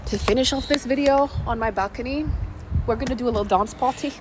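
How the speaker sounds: usual speaking voice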